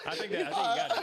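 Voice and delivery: high, slow laughing words; cracking up hard. Real, not acted. Group cracking up.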